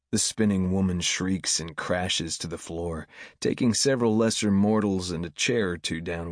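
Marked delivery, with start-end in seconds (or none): none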